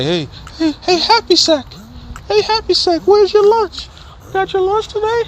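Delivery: mock a teacher taunting a kid about his lunch; teasing teacher. Imitating teacher teasing lunch bag.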